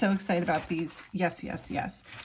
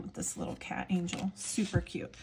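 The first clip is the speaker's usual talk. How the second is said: whisper; 9 dB quieter than usual. Real, not acted. Soft aside about cat angel.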